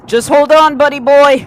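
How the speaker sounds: loud, high urgent reassurance; urgent shout. Acted. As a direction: shout him through the panic. Comforting a shot friend loudly.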